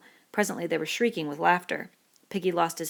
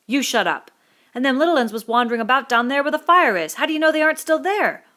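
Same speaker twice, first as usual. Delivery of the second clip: put-on a furious boy, much louder and higher; Jack angry; 8 semitones higher, 9 dB louder than usual. Jack turning on Piggy, angry and loud. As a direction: snap back hard at him.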